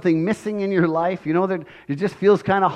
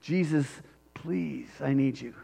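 much softer, a broken near-whisper plea; quiet pleading; 4 semitones lower, 13 dB quieter than usual. Retold or imitated. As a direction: let the begging sink to almost nothing. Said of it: "And he earnestly pleaded".

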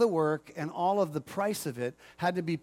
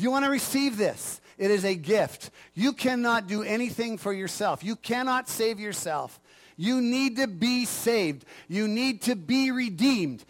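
raised, measured preacher's appeal; emphatic preaching; 4 semitones higher, 3 dB louder than usual. Real, not acted. Preacher urging listeners to receive the gift, emphatically. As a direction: press each phrase home with conviction.

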